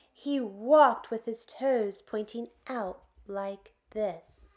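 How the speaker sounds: ordinary delivery, nothing marked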